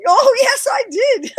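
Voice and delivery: loud, high-pitched, laughing insistence; retold gleeful confession. Acting out her confession amid laughter.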